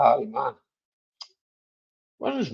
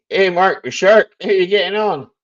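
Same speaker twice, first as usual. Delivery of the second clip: loud hearty greeting; 6 semitones higher, 7 dB louder than usual. Real, not acted. Calling out a greeting to Mark.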